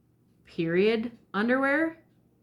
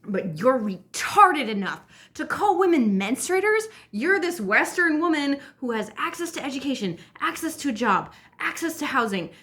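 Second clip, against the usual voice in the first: high, incredulous tirade; angry rant; 7 semitones higher than usual. Real, not acted. Vlogger berating a privileged Western woman, angry rant. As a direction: hammer out the list in outrage.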